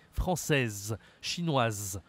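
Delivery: "Française, chinoise" each ending in a clearly sounded z; pronounced feminine endings. Shown as a demonstration. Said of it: "On prononce la fin du mot".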